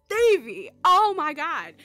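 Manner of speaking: high, loud, drawn-out gushing; excited exclamation. Retold or imitated. Vlogger recalling her reaction to Stevie's shirt, excited.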